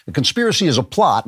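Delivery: normal talking voice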